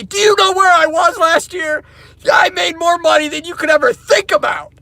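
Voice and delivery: high, loud boastful shout; imagined outburst. Pretend. Telling off the dealership managers in a loud boast. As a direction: blurt it out at the boss. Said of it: "I wanna say".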